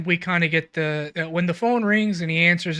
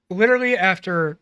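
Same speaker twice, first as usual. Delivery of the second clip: ordinary delivery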